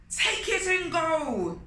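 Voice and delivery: high, loud furious shout; angry shout. Acted. Enraged king shouting at Anansi to leave. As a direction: spit it at them in rage. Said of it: "he shouted".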